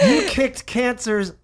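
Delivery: laughing. Real, not acted. Laughing about the A word.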